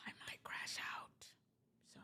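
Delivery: hushed, voiceless whisper; whispered aside. Real, not acted. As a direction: whisper it as a quiet confession. Aside on tomorrow's possible loss.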